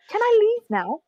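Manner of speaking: high-pitched plea to go; eager to leave. Acted. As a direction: pipe up high, asking to be excused. Character asks permission to go.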